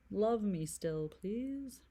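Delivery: usual speaking voice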